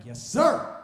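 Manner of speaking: loud, snapped-to reply to the boss; acted eager shout; eager obedience. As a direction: shout it like a keen new recruit.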